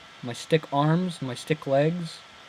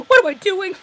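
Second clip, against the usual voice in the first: much higher and louder, laughing at yourself; amused disbelief; 8 dB louder than usual. Real, not acted. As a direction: squeal at your own blunder. Laughing at own mistake.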